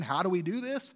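ordinary delivery

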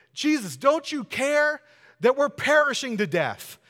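hammed-up terror of men on a sinking boat; frantic plea over the storm; panicked disciples. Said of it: "they're freaking out".

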